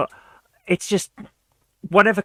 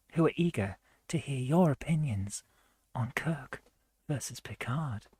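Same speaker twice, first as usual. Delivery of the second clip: late-night chatline advert; 3 semitones lower, 9 dB quieter than usual. Pretend. Mock Saturday-night advert to Star Trek fans, hushed seductive pitch. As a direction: tease it out softly with long pauses. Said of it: "All those old adverts".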